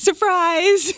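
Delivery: high, loud cheer of surprise; spring the big reveal. Real, not acted. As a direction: mom shouts surprise to her daughter. Mom reveals concert tickets.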